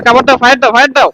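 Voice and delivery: urgent loud call for cover; cover me shout. Real, not acted. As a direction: yell for backup mid-fight. Teammate pinned down, calling for cover.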